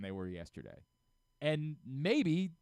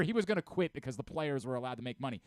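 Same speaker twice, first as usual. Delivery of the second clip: ordinary talk, nothing marked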